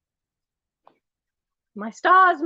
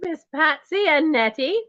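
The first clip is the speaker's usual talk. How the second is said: ordinary talk, nothing marked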